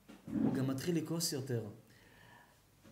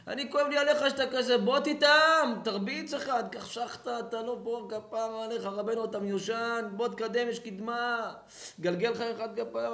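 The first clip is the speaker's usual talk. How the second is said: higher, louder pushy stoner; mock stoner friend; 6 semitones higher, 4 dB louder than usual. Stoned friend urging the rabbi to smoke, higher mock voice. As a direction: urge him to take a hit.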